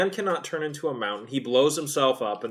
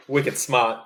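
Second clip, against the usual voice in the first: put-on Boston accent. Quoting a Boston catchphrase.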